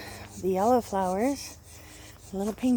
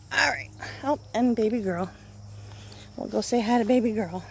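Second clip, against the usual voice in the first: ordinary talk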